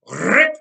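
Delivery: ripping exclamation. A narrator's reading. Mourner ripping cloth mid-speech.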